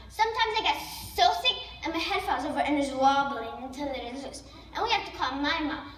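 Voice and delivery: usual speaking voice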